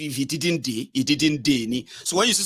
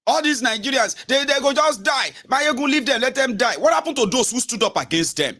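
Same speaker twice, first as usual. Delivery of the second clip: mock critic; 9 semitones higher than usual. Mimicking critics blaming Mayegun.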